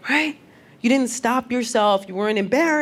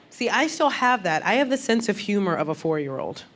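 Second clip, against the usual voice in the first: ordinary talk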